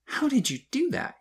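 put-on high-pitched boy's amazed question; awed asking. A narrator's reading. Boy amazed by the warming drink, in awe. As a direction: ask it high, full of wonder. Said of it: "he asked in awe".

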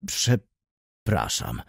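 low, drawn-out, halting apology; flustered stammer. A narrator's reading. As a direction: stumble over the word, low and slow. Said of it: "Nareszcie wybąkał". (niskie, zmieszane wybąkanie)

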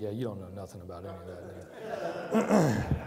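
laughing wife. Retold or imitated. Wife laughing at the pastor giving this talk. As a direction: tease him while laughing. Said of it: "she's laughing".

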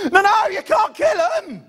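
high pleading shout at the father-in-law; panicked shriek. Retold or imitated. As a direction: shriek to save the spider. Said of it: "and I go".

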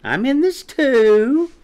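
high, loud, drawn-out chirp. A narrator's reading. Quinny insisting to Chick she's included, loud chirp. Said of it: "chirped Quinny".

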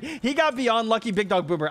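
ordinary delivery

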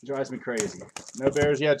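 ordinary talk

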